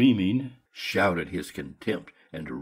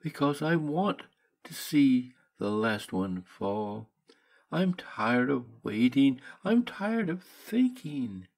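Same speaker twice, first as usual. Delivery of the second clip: put-on higher and slow, a worn-out girl longing to let go; lightly voiced frail young woman, slow and higher; weary sick young woman; 7 semitones higher than usual. Johnsy voiced by narrator.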